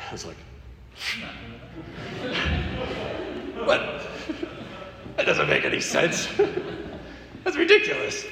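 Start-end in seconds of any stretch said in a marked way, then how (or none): 1.0-8.2 laughing, much higher, disbelieving; laughing disbelief. Retold or imitated. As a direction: laugh it off as absurd, voice climbing high. Laughing at God's odd instruction.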